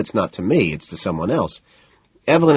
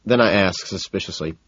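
plain reading voice

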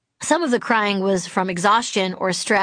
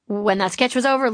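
usual speaking voice

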